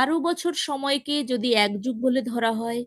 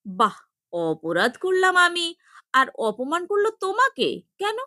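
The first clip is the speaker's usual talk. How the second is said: laughing; 6 semitones higher than usual. A narrator's reading. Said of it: "হেসে বলে ফেলে".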